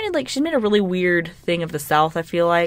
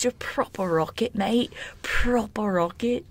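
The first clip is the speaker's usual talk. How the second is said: badly faked British accent, pitched up; terrible British accent; 5 semitones higher, slower than usual. Friend mimicking Love Island lingo for listeners, terrible British accent. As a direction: have a clumsy go at British slang. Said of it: "that's a terrible accent".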